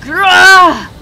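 huge agonised roar; loud roar of pain. Acted. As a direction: bellow as the arm is cut. Giant loses his arm.

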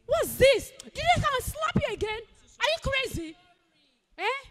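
furious scolding. Acted. Woman scolding the waiter.

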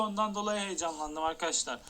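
ordinary talk

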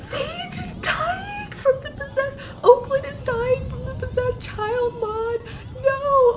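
very high, drawn-out tearful wail; distraught wail. Real, not acted. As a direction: wail slowly in horrified grief. Her Sims are dying.

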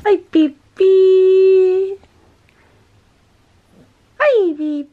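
high, loud, drawn-out coo. Real, not acted. Greeting pet in baby talk.

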